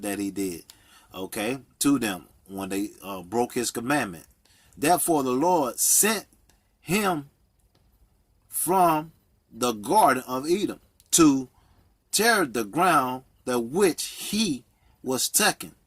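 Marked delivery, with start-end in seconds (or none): 4.8-15.7 emphatic, slow preacher's reading with pauses; scripture reading. Real, not acted. Preacher reading Genesis to listeners, emphatic scripture reading. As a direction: proclaim the verse phrase by phrase.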